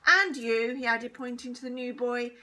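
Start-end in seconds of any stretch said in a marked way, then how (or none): 0.1-0.8 very loud stern accusation; loud condemning. A narrator's reading. Teacher points at new boy, loudly condemning. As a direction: fire it at the next culprit.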